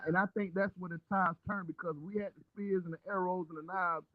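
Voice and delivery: muffled, cutting-out mic audio. Real, not acted. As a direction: sound like through a wall. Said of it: "You sound muffled".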